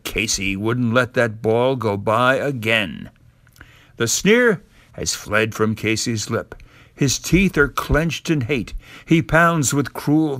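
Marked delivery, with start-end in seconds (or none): none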